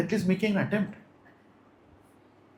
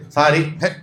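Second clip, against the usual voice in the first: token sorry; 6 dB louder than usual. Retold or imitated. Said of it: "Doesn't mean just say".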